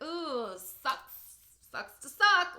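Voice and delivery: sing-out jeer, ending high and loud; hammed-up gloating, building to a loud finish; mock taunt. Mocking voice of imagined uncaring message.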